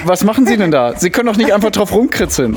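high indignant protest; outraged alarm. Acted. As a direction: object in horror. Sketch, she scribbles on painting.

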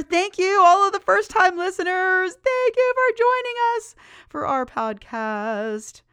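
high, loud, gushing gratitude; elated shout-out to listeners; excited thanks. Real, not acted. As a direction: call out thanks at a high pitch. Gushing thanks to first-time listeners.

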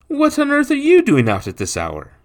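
very high, loud astonishment; shocked gentleman. A narrator's reading. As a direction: exclaim in disbelief at finding a child out. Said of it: "shocked at seeing the child".

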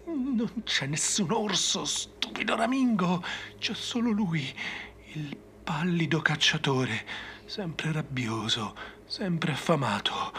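high, slow, creepy voice sinking to a whisper; eerie madman. Acted. Madman warns the stupid ranger of the pale hunter, slow and eerie. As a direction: taunt slowly, high and unhinged.

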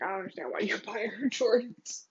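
ordinary talk

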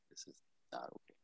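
hushed whisper of despair. Real, not acted. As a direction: breathe it as a whisper. Repeated, dropping to a whisper.

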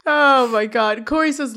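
ordinary talk

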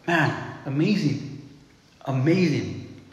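soft, slow, marvelling "amazing"; hushed awe. Real, not acted. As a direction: let the wonder sink in quietly. Preacher marvels at the prophecy.

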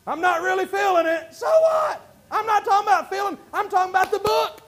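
loud, high, impassioned sermon voice; preacher rallying the church to amen; full-force preaching. Acted. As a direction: shout it out to the congregation.

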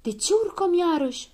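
high-pitched puzzled question; high storybook surprise; wondering. A narrator's reading. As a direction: ask in amazement, voice lifted. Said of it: "se miră probabil".

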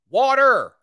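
"Water." called out loud as a one-word demo. Shown as a demonstration. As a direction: belt out "Water." as the example. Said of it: "a geographical way of speaking".

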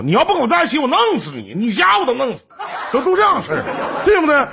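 模仿现在男人的狠话. Retold or imitated. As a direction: squeal it like a mock thug. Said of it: "你不像现在这男的". (拔高嗓门夸张地学现在男人的威胁)

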